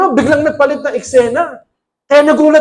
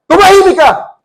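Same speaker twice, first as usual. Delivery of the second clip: high, loud rebuke; commanding shout; 9 semitones higher, 5 dB louder than usual. A narrator's reading. Jesus driving out a demon, loud commanding shout. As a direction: cast the demon out with force. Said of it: "sabi niya".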